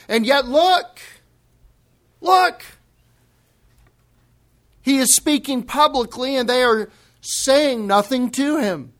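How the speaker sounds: high, loud, urgent pointing out; excited crowd voice. Acted. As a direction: play the crowd nudging each other to look.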